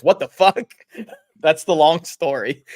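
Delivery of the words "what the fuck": loud startled what the fuck; shocked outburst. Retold or imitated. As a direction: blurt it out in shock. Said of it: "He goes".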